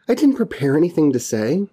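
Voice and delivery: normal talking voice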